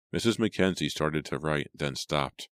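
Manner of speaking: plain reading voice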